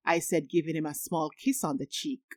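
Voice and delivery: usual speaking voice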